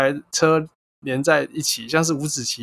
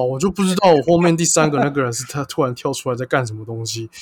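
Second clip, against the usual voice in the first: normal talking voice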